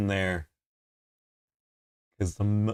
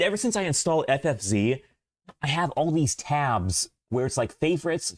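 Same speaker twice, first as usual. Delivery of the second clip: normal talking voice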